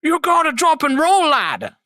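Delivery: put-on high, shouted Irish accent, dropping on last word; hammed-up high-pitched Irish cry. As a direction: yell it like an excited Irishman. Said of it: "that Irish accent".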